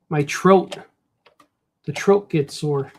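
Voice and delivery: Chicago "throat" with a hard T; Chicago-style "trote"; Chicago accent. Acted. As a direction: say it like a Chicago guy. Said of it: "That's the way Chicago guys say it".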